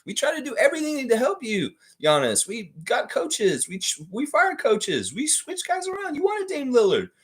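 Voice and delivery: high, whiny team executive begging; high pleading front office voice. Retold or imitated. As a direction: plead like a desperate team boss. Voicing the Bucks front office pleading.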